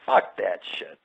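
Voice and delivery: hushed whisper; whispered aside. Real, not acted. Dismissing the truck idea, whispered.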